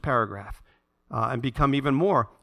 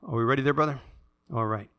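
normal talking voice

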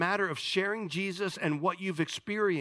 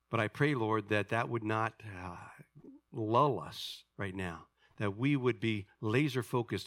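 low, measured praying voice; reverent prayer; 5 semitones lower than usual. Real, not acted. Leads congregation in prayer.

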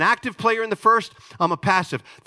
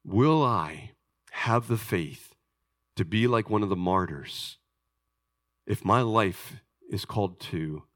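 hushed and solemn; 11 semitones lower, 5 dB quieter than usual. Real, not acted. Pastor confides to his church, hushed and grave. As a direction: drop to a hushed voice over the martyrs.

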